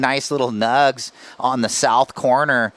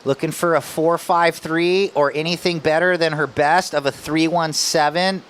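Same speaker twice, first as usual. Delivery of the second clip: ordinary talk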